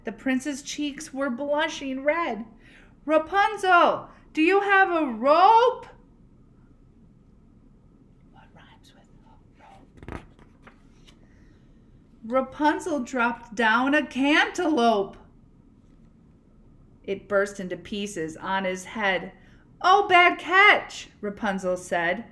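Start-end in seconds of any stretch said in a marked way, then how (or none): 3.1-5.8 higher, louder call with a rising ask. Acted. Prince calls up the tower. 8.3-10.2 whisper. Real, not acted. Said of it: "teacher whispers to class". 19.8-21.1 higher, louder, slow mock pity; Rapunzel calling down. Rapunzel calls down.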